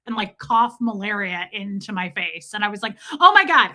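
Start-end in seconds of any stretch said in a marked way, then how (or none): 3.2-3.7 high and loud horrified exclaim; mock horror. A kid coughs in her face, she shrieks in horror. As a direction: recoil out loud in disgust.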